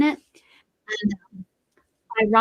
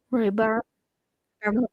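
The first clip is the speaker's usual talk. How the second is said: normal talking voice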